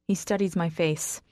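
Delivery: ordinary delivery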